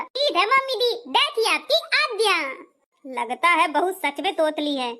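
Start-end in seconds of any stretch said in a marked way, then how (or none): 0.0-2.4 lisping and pitched higher, "मम्मी दी" said with a lisp; a sweet, higher put-on lisp when she agrees; lisping bride. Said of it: "तोतला के गाऊंगी और तोतला के बोलूंगी". (ऊँची आवाज़ में तुतलाती बहू)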